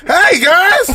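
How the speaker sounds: high, loud, bright hey guys; hammed-up peppy host greeting; bubbly greeting. Shown as a demonstration. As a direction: burst in overly cheerful. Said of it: "If I would've answered like that".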